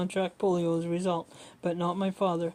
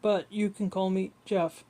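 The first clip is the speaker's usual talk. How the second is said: ordinary delivery, nothing marked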